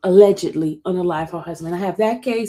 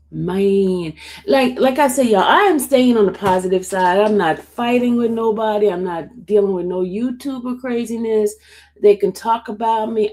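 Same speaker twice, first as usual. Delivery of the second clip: usual speaking voice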